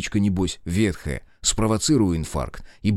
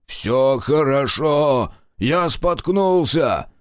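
louder, slow, shaky call downstairs; trembling shout; 5 semitones higher, 4 dB louder, much slower than usual. A narrator's reading. Captive calling down to grandfather, trembling shout. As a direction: call out, trying to hide fear. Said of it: "крикнул дрожащим голосом".

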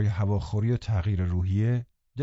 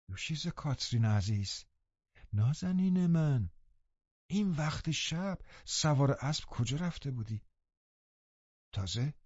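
higher, softer nurse voice coaxing the truth; tender scolding; 5 semitones higher, 6 dB quieter than usual. Acted. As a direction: lean in, coax her gently then press. Nelly gently scolds Catherine. (سرزنش مهربان با صدای آرام)

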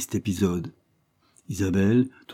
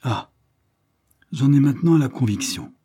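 eager and louder, a touch higher; enthusiastic; 5 semitones higher, 4 dB louder than usual. A narrator's reading. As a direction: Barbara pressing her conviction. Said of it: "répéta Barbara avec enthousiasme".

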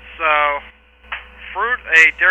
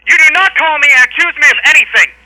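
raised, higher-pitched indignant voice; angry rebuttal; 4 semitones higher, 4 dB louder than usual. Real, not acted. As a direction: fire back at the accusation, hard and high. Clerk angrily rebuts the caller's insult.

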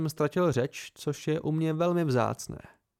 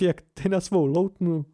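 higher and louder, voice cracking; voice breaking; 4 semitones higher, 4 dB louder than usual. A narrator's reading. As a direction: let the voice break at the end. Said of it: "Zlomil se jí hlas".

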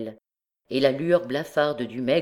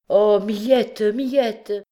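high, louder cry of distress; distraught appeal; 7 semitones higher, 6 dB louder, much slower than usual. A narrator's reading. Troubled man appeals to the poor woman, distraught cry. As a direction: plead her name in dismay. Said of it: "dans son trouble".

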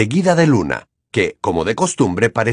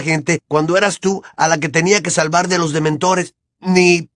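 angry shouting; 8 semitones higher than usual. Acted. Harry's continued outburst.

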